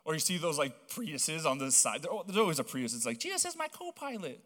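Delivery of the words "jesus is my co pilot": much higher, prissy mock voice; mocking sticker voice. Preacher mocking Prius drivers in Idaho, silly high voice.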